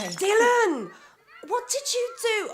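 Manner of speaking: loud, high scolding shout; yelling at child. Acted. Mother to her son who broke a vase, sharp shout. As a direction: catch the kid in the act.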